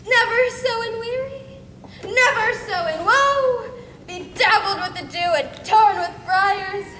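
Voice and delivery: a world-weary lament sinking to a near-hush; weary verse, fading. Acted. Hermia lost in the woods, fading from tiredness.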